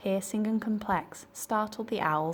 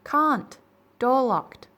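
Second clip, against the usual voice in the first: high, loud shout, clipped words; deep loud reply; 7 semitones higher, 8 dB louder, much slower than usual. Acted. Cyril answers Andrew from the tower, shouting. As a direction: call it down short and loud. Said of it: "the same voice replied".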